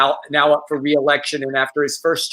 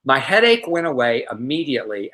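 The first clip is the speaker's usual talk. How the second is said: ordinary delivery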